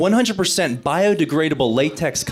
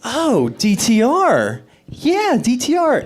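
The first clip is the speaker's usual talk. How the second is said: mock dawning surprise; 6 semitones higher, slower than usual. Mock realisation in dialogue.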